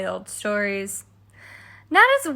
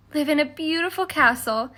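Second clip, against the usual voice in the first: plain reading voice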